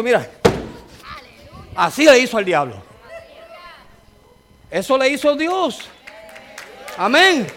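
loud, high, fervent preacher's cry; preaching at full force. Acted. Pastor rousing his congregation about God's power, loud and fervent. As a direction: thunder it out to the church like a revival preacher.

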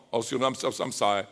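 ordinary talk, nothing marked

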